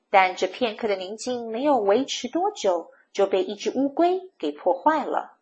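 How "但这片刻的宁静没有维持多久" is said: plain reading voice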